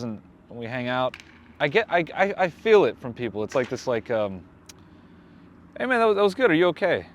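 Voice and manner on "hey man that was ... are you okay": high-pitched, gingerly worried check-in; raised, over-careful mock concern; imitated concerned tone. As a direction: mimic people tiptoeing up to a troubled friend. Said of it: "that's the tone when people talk to me".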